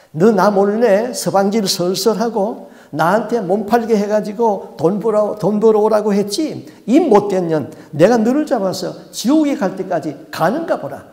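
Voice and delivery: rip into the mother; the demon exposing her sins in a rage; cursing demon. Retold or imitated. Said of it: "욕을 하는데요".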